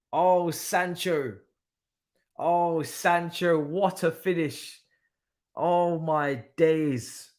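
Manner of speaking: slow, drawn-out high marvelling; awed disbelief. Real, not acted. Marvelling at Sancho's finish, slow awed drawl. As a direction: stretch each word out in amazement.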